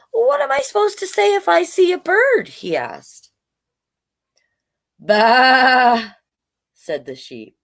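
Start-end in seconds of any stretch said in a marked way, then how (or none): 0.1-2.5 high-pitched little dog voice; puzzled dog character. A narrator's reading. Voicing puzzled dog asking sheep. 5.0-6.1 loud, drawn-out high baa; sheep bleat. A narrator's reading.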